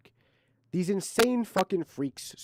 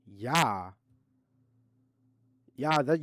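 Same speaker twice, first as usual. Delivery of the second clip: ordinary talk, nothing marked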